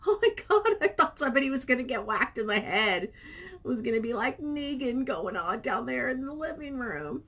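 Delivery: high, loud disbelief; retelling to husband, loud excited disbelief. Retold or imitated. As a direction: gush that someone nearly got whacked. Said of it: "And I was like".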